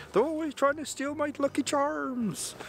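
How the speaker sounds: very high, hammed-up Irish leprechaun accent; terrible Irish accent. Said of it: "That was a terrible accent".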